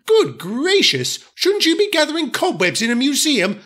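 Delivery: high, disdainful, sniffy; haughty diesel lady. Acted. Daisy complains about the smelly shed, high and sniffy. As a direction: turn up your nose at the shed. Daisy mocks old coaches.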